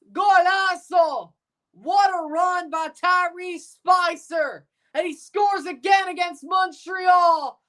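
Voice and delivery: high, excited shouted soccer goal call with drawn-out words; live goal call. Real, not acted. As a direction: erupt over the goal, pushing your voice and stretching the names.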